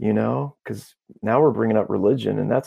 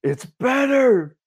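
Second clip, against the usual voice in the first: high, drawn-out mock astonishment; amazed reaction; much slower than usual. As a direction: squeal it slowly as the astonished man. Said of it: "his reaction was so priceless".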